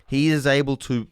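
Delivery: ordinary delivery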